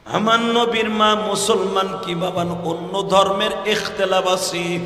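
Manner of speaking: sing-song preaching, stretch the last syllable of each phrase. Real, not acted.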